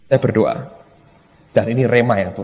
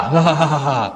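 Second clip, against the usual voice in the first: udawany śmiech. Retold or imitated. Kita ikut ketawa.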